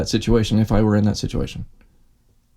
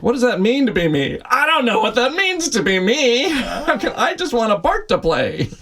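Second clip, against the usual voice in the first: put-on confused teenager; 12 semitones higher than usual. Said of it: "I was like".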